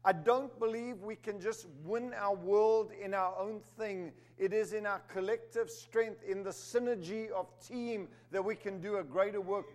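firm, rising sermon delivery; preaching. Acted. Preacher calling the church to collective strength, firm. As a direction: press the point home to the congregation.

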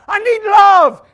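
high, loud mock pleading. Christians coming to God, loud mock pleading. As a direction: whine it at a shout, very high. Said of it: "Christians coming to God and say".

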